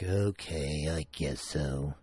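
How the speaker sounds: lightly voiced reluctant husband; husband's reluctant voice. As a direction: drag it out like a half-willing husband.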